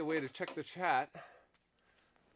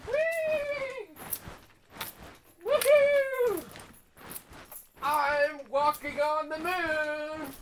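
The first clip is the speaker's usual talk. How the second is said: gleeful squeals; 8 semitones higher, much slower than usual. Real, not acted. Bouncing around for the stream, squealing with glee.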